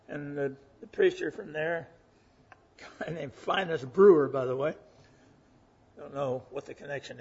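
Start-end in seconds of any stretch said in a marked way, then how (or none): none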